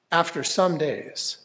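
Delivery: ordinary delivery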